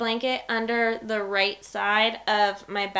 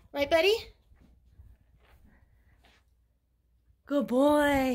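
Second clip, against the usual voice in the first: dog praise voice; 5 semitones higher than usual. Real, not acted.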